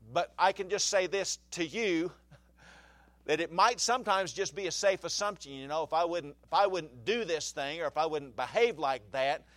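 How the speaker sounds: loud, driving sermon voice; fervent preaching. Acted. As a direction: preach it out to the congregation. Preaching about sin and sickness.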